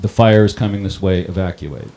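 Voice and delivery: a touch of a public address blare; mock PA announcement. Retelling the PA car's order, loud announcement.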